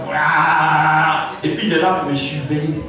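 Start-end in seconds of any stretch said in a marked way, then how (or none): 0.1-1.3 long, drawn-out sleepy yawn sound. Retold or imitated. Sheep falls asleep after eating, yawning. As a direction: stretch out a lazy yawn. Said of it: "il fait".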